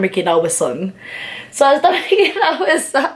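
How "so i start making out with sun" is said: laughing through the words, higher. Real, not acted. Confessing to viewers she kissed Sun, laughing. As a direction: giggle it out, embarrassed.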